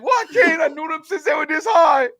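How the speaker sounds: high, loud Cajun wail; hammed-up Cajun outcry; shouted punchline. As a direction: yell it in mock despair. Joke punchline shouted in character.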